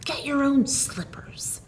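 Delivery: unvoiced, soft rebellious whisper; wife's hushed defiance; whispered retort. A narrator's reading. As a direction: breathe it, never daring to say it aloud. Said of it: "had trembled on her lips".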